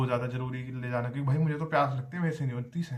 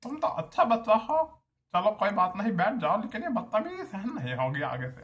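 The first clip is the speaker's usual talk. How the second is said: put-on HOD's accent; 7 semitones higher than usual. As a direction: imitate the HOD's accent letting the student sit down. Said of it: "जैसे मैंने इस accent में बोला ना". (HOD के लहजे में ऊँची, धीमी चेतावनी)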